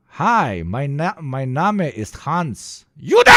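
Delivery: hammed-up German tourist; loud, slow German accent. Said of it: "the classic German sayings".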